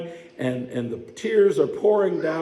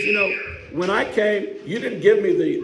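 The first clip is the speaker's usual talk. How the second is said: higher, louder, slowing at the end; a touch of laughing disbelief at the end; Jesus rebuking; 6 semitones higher, 4 dB louder than usual. Retold or imitated. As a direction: press Simon with mounting indignation.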